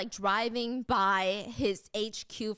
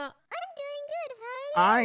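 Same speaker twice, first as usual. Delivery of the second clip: puppet voice; 3 dB louder than usual. Acted. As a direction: answer as a high-pitched puppet.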